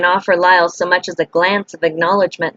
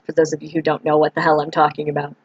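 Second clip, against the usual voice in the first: usual speaking voice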